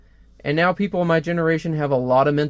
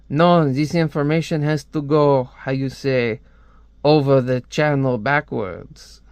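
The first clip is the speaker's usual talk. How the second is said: put-on French accent. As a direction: fumble for the English phrase in a French accent. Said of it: "I hate doing a French accent".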